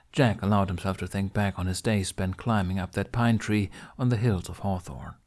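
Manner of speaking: plain reading voice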